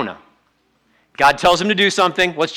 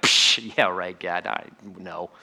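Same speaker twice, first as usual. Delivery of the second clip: breathy psh, then much lower and softer scoff; scoffing dismissal; 9 semitones lower, 7 dB quieter, slower than usual. Pretend. Preacher acting a listener scoffing at God's invitation. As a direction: brush it off, half-mumbling.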